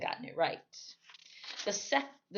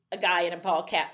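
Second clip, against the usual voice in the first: ordinary delivery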